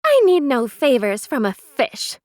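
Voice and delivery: loud, scornful retort; derisive. A narrator's reading. Neris scorning the begging girl, derisive. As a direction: sneer it down at her. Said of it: "came the derisive retort".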